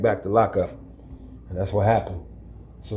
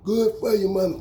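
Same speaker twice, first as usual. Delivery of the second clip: sneering inmate voice; 9 semitones higher, 4 dB quieter than usual. Retold or imitated. Inmate taunt again.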